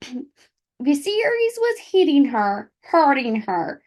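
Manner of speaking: hammed-up Midwest mom accent. Shown as a demonstration. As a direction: read it like a chatty Midwest mom. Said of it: "a Midwest mom accent".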